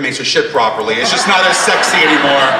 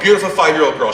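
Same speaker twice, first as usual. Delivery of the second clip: ordinary talk, nothing marked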